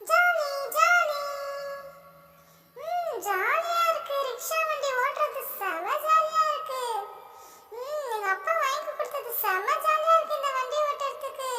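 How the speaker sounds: lightly voiced happy kid enjoying the ride; happy child voice. Playing a happy child character. (Rickshaw ஓட்டும் சிறுவனின் மகிழ்ச்சியான குரல்)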